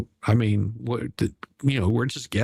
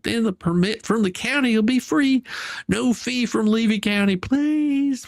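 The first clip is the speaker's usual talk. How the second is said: starts near normal, climbs to a much higher, pleading whine; mocking whiny letter; 6 semitones higher than usual. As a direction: mocks a begging letter by whining it ever higher. Mockingly reads Michelle's pleading letter.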